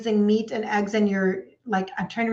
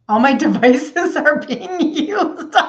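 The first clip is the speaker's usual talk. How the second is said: laughing through the words, higher and louder; 6 semitones higher, 6 dB louder, slower than usual. Real, not acted. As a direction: laugh as you say it. Laughs at her own setup.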